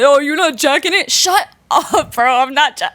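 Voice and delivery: high, heated mock back-and-forth; mocking argument. Acting out people teasing her slang, mock-indignant.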